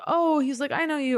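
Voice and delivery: high, bright "oh, I know you"; high imitated delight; delighted recognition. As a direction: play the doctor happily recognizing her. Retells the doctor recognizing her.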